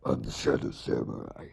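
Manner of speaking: very low, growly fake demon voice; mock demonic voice. Vlogger introducing himself to newcomers, mock demonic. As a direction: do a horrible demonic impression of yourself. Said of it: "horrible impression of my demonic voice".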